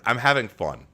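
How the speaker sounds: ordinary talk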